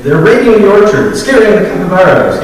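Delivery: high, loud cry of alarm. A narrator's reading. Rowan calling villagers to the orchard, urgent high cry. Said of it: "he cried".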